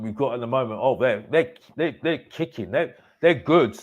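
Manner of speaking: ordinary talk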